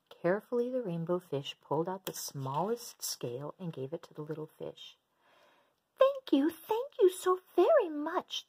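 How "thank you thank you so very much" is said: very high, loud squeaky thanks; bubbly thanks. Acted. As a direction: bubble over with delight. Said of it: "bubbled playfully".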